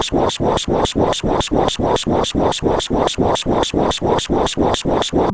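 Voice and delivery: breathy voiceless whoosh, again and again; mock whooshing noise. Narrator mocks a crude act with a whooshing noise. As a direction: spit out a rhythmic whoosh sound. Mock sound effect in rant.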